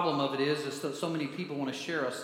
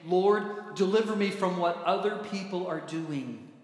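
raised-voice prayer for deliverance; loud plea; 5 dB louder than usual. Acted. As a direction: call out to God, loud at the start. Acted plea of the psalmist.

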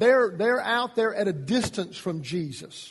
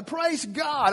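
high, slow, drawn-out joyful praise; imagine you were just healed; a happy cry of thanks; excited praise; 7 semitones higher than usual. Pretend. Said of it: "I'd be so excited".

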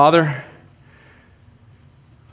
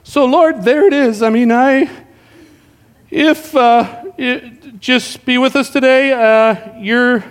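higher, halting, fumbling prayer; stumbling new guy; 5 semitones higher, slower than usual. Retold or imitated. New guy can't end his prayer, high and fumbling. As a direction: grope for an ending, pitched up. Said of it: "They'll be like".

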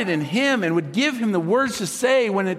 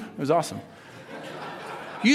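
usual speaking voice

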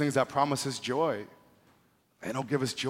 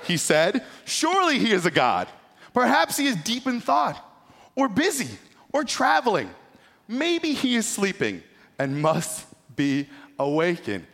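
raised, lively reading of the taunt; scripture reading; 6 semitones higher, 5 dB louder than usual. Acted. Preacher reading Elijah's taunt to the congregation, raised and lively. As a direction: read the passage big, building the mockery.

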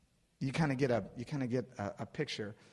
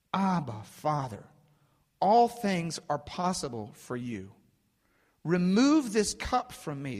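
slow, forceful plea; acted anguished appeal to a father; begging prayer; 4 semitones higher, 6 dB louder than usual. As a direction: plead slowly with rising intensity. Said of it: "he begs the Father".

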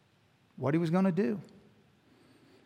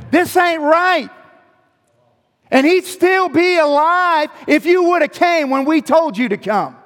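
louder, higher, accusing friend betrayed; accusing, hurt Martha; 11 semitones higher, 10 dB louder than usual. Acted. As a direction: confront Jesus for letting her down. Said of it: "Or do you think she's saying".

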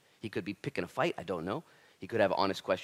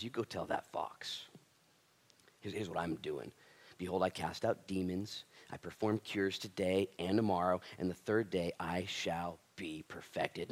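softer, slow, steady reading of Jesus' reply; quiet solemn reading; 6 semitones lower, 7 dB quieter than usual. Acted. Jesus answering the Pharisees, quiet and resolute. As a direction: read it low and resolute.

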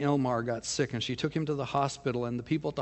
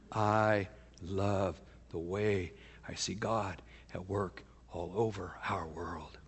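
much softer, slow, fading into a whisper; hushed whisper; 5 semitones lower, 5 dB quieter, slower than usual. Real, not acted. Pastor marveling at God's work worldwide, slow hushed whisper. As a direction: breathe it out in awe. Tender moment of awe.

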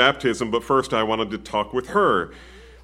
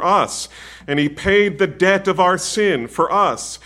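louder, higher, rising sermon fervour; preaching at full force; 4 semitones higher, 4 dB louder than usual. Acted. Pastor preaching the gospel to the mourners, fervent and building. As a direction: drive the gospel home to the congregation.

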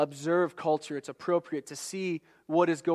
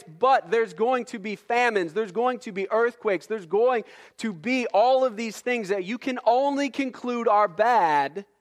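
fast, insistent preaching that climbs higher; building sermon reading; 5 semitones higher, 5 dB louder than usual. Acted. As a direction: drive the list of woes home, climbing. Preaching the list of woes.

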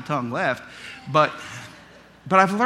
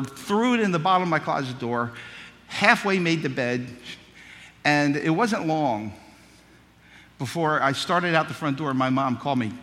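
ordinary talk

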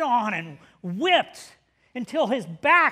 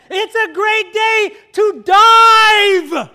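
high, stretched-out bellow; full yell; 8 semitones higher, 14 dB louder, much slower than usual. Retold or imitated. Instructor repeating himself to the jumper over propellers, full-voiced shout. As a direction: shout it over engine noise, holding the last word.

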